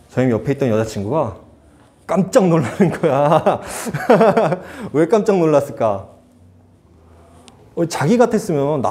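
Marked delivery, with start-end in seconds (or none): 2.1-6.1 real laughter breaking into the words; laughing. Real, not acted. Pastor recalls his girlfriend's astonishment, laughing. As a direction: tell it through a laugh.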